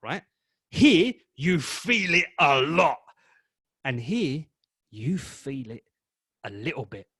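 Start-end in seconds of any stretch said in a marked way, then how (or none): 0.7-3.0 slow and loud, leaning on every word; full-strength emphasis; big emphasis. Shown as a demonstration. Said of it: "the honoring of the word scale". 4.9-7.1 softer and lower, barely leaning in; a soft little-bit delivery; low intensity. Shown as a demonstration. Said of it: "the honoring of the word scale".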